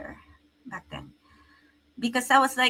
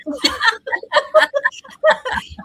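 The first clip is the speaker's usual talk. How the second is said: a long high burst of laughter; 6 dB louder than usual. Real, not acted. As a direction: laugh out loud at the question. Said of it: "you're making me do a lot of math".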